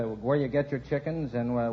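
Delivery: normal talking voice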